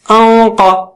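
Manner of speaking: sustained heavy hum released into قا; heavy ghunna. Shown as a demonstration. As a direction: hold the n of انقا long and full. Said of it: "نون ساکنہ بھی اونچا ہو جائے گا".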